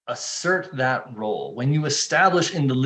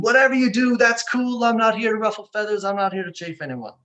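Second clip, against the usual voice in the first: much higher, louder breezy yes-man voice; people-pleasing consultant; 8 semitones higher, 4 dB louder than usual. Acted. Consultant placating a client, higher and breezy. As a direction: play the consultant who never rocks the boat.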